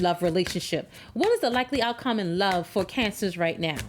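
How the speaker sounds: ordinary talk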